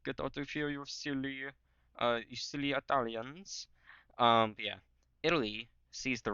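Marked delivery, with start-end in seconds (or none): 0.0-3.7 higher-pitched fake Austrian taunt; hammed-up Austrian "ho ho" gloating; mock Austrian accent. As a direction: jeer in a put-on Austrian accent. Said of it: "the Austrians are gonna be like".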